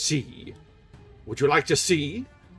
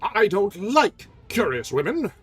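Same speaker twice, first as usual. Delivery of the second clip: slow, louder, higher cold retort; 9 semitones higher, 7 dB louder than usual. Acted. Erik warning Christine, cold retort. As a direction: snap it back, slowly. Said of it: "he retorted".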